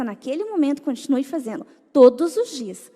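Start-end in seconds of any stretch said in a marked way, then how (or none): 1.9-2.9 much louder, insistent; emphatic. Real, not acted. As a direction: hammer home every day. Repeated with emphasis. (enfático e bem mais alto)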